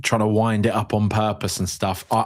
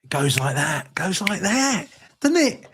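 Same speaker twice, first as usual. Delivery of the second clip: very high, put-on wistful voice; mock old-timer musing; 13 semitones higher than usual. Host to listeners, hammed-up high wistful voice. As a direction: sigh about time like a caricature.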